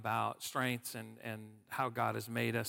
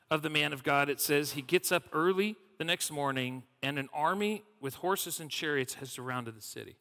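ordinary talk, nothing marked

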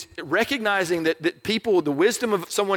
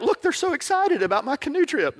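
clueless canoer; 9 semitones higher than usual. Retold or imitated. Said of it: "And they're like".

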